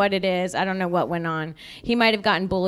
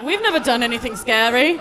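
mock innocent voice; 4 semitones higher, 4 dB louder than usual. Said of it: "They were all innocent, like".

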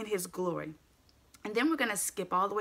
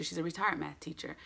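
normal talking voice

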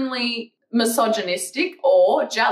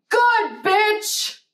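sassy yell; 11 semitones higher than usual. Real, not acted. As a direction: snap it back at the hater. Answering a rude comment.

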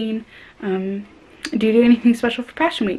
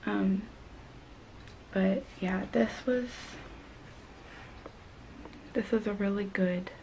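hushed voice; 3 semitones lower, 11 dB quieter than usual. Real, not acted. Late-night hushed talk.

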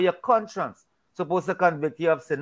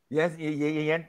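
ordinary talk

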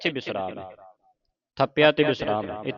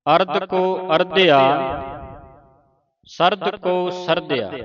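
higher recitation, ਅਰਧਿਆ and ਸਰਦਿਆ each unbroken; joined words, final pause; 4 semitones higher, 4 dB louder than usual. Shown as a demonstration. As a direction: rest only after ਅਰਧਿਆ and ਸਰਦਿਆ. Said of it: "ਇਕੱਠੇ ਪੜ੍ਹਨਾ".

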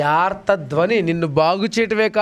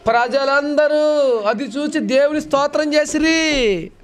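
preaching; 5 semitones higher, slower than usual. Acted. Preaching reading scripture.

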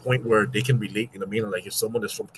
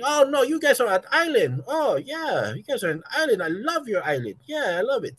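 high-pitched gushing tourist voice; exaggerated high cooing over the island; imitated foreign tourist; 14 semitones higher, 4 dB louder than usual. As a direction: mimic a delighted visitor, loud and sing-song. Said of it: "they're just gonna be like".

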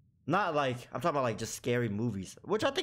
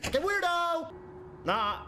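mock shriek; slower than usual. Mock insults the note writer.

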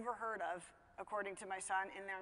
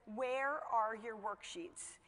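very slow, deliberate questioning; stern demand; much slower than usual. Retold or imitated. Mother confronting her son over missing worksheets, slow and stern.

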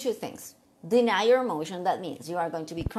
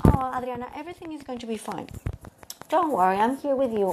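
lightly voiced reassuring mom, slightly higher; comforting mother; 5 semitones higher, 4 dB louder, faster than usual. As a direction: soothe her like a mother would.

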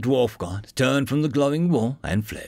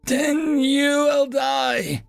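very high, slow bellow; 3 dB louder, much slower than usual. A narrator's reading. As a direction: bellow as you charge. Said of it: "the dwarf god bellowed".

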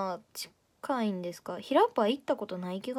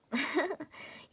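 short breathy giggle; a quick soft laugh after the joke; 4 semitones higher, 5 dB quieter than usual. Real, not acted. Streamer giggling at a viewer's late apology, soft laugh.

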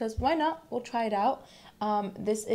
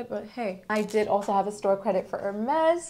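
ordinary talk, nothing marked

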